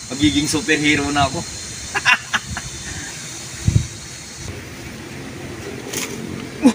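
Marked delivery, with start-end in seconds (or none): 1.4-6.6 high breathy evil cackle; villain laugh. Acted. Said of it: "parang kontrabida yung tawa".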